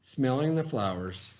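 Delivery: put-on reading a story aloud to children; lively read-aloud for young listeners; expressive picture-book narration; storybook reading. A narrator's reading.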